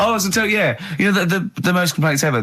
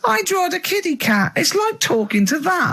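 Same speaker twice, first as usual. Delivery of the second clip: much higher childlike cartoon voice, slow at the end; Ralph impression. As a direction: squeak it out like a dim little cartoon boy. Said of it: "Is it Ralph in The Simpsons?".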